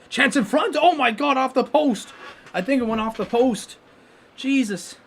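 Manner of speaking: excited shout. Real, not acted. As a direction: lose it as the puck hits the post. Shot rings off the post.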